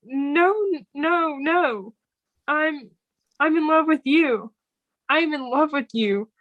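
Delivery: blurting out she loves him; flustered confession. Retold or imitated. Friend acting out Rita confessing her love, lightly flustered.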